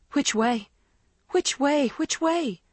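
building from worried to panicked high; rising panic. Acted. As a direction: panic growing with each repeat. Lost in dark, panic rising.

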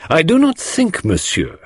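very high-pitched, dropping on the address; pointed. A narrator's reading. Poirot challenges the mourning husband, pointedly. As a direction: make a pointed challenge to him. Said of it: "said Poirot pointedly".

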